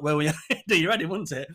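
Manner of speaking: ordinary delivery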